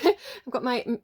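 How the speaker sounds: ordinary delivery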